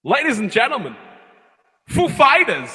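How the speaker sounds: high, loud mock Walken introduction; stage announcement. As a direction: announce the band to a whole audience. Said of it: "leant out live in front of an entire audience and went".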